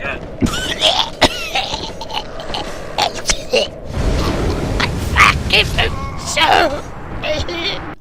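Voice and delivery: long hammed-up choking cough; mock coughing. Player hams up toxic coughing, long and choking. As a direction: cough through the poison. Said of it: "Oh, I'm toxic".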